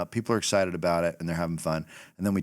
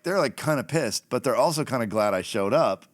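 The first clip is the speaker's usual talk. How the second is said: normal talking voice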